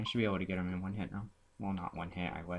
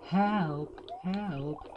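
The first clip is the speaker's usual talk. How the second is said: high-pitched damsel-like call; mock cry for help; 10 semitones higher than usual. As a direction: call out in pretend distress, pitched way up.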